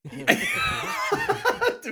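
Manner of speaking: sudden squealing laugh; high shriek laugh. Real, not acted. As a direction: burst out in a shrill laugh. Reacts to accent joke.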